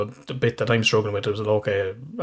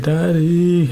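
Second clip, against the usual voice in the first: higher, louder pleading call; retold tearful child; 7 semitones higher, 5 dB louder than usual. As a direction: imitate a crying child calling out. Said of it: "and he's crying".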